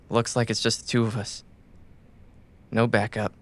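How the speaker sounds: normal talking voice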